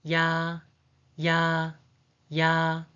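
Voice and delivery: "ya" with a quick y-glide into a long, level ah; separate, drawn-out "ya" for learners to copy; vowel demo. Shown as a demonstration. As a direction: say "ya" evenly, holding the vowel on one pitch. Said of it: "The pronunciation is".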